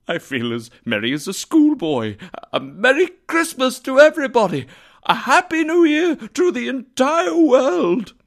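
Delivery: high, loud burst of elation building to a shout; overjoyed cry. A narrator's reading. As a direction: shout festive greetings to the whole world, giddy with joy. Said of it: "laughing and crying at the same time".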